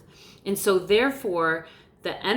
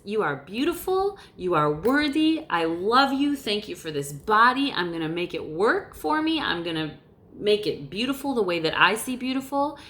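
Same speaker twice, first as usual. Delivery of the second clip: a self-affirming pep talk; pep talk to yourself. Shown as a demonstration. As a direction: cheer yourself on like your best friend. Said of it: "you look in the mirror and you say".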